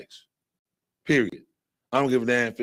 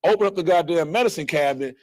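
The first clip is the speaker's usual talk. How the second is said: ordinary talk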